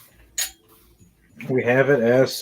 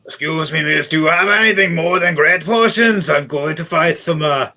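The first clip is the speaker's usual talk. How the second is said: loud, higher-pitched dwarf customer; raised, projecting dwarf voice; 5 semitones higher, 4 dB louder than usual. Acted. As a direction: play a hearty dwarf asking for weapons, pitched up. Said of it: "a short dwarf and frozen looking man".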